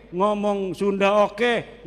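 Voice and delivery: normal talking voice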